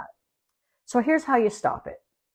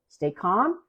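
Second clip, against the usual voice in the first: usual speaking voice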